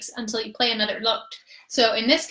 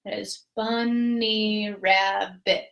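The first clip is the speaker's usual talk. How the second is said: bunny rabbit drawn out in four beats; counting syllables; very slow syllables; 2 semitones lower, much slower than usual. Shown as a demonstration. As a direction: spell the beats out slowly. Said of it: "That's four syllables".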